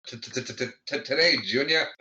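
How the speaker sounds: mock stammer on the first sound; stuttering. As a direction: get stuck on the T, then blurt it out. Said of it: "keeps stuttering and not saying".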